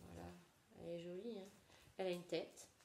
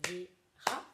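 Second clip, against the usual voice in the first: verra en deux temps, vè-rra; syllabes frappées; 8 dB louder than usual. Shown as a demonstration. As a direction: scande verra en deux coups. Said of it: "on frappera deux fois". (verra en deux syllabes)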